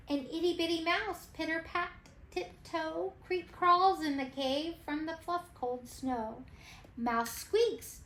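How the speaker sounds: usual speaking voice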